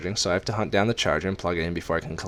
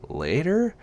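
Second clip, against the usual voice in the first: much higher, rising sharply at the end; startled question; 11 semitones higher than usual. Acted. Narrator puzzles over Luke's parting word, startled question. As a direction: echo the word in surprised query.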